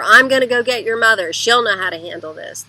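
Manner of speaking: scolding parent threatens to fetch mother; threatening parent. Retold or imitated. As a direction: snap it at the kid. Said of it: "telling on him to other people".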